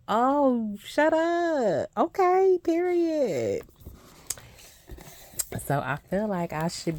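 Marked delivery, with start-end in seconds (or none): 0.1-3.6 much higher, louder, gushing squeal; excited squeal. Retold or imitated. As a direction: squeal it like a fan who is sold. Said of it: "I was like".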